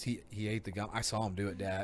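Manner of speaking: low, very soft retold kid; quiet earnest tattling. Imitating son Connor telling on Drake, quiet earnest retelling. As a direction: report it softly and matter-of-factly.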